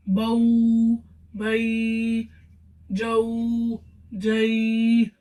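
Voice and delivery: बऊ बई जऊ जई dragged out far too long; wrong stretched reading. Shown as a demonstration. Teacher showing students the wrong stretched way, very slow. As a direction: stretch each vowel way too long, as the error. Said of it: "खींच कर नहीं पढ़ना".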